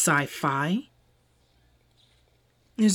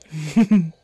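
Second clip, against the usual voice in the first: knowing laugh; 9 dB louder than usual. Acted. Teasing human's reaction.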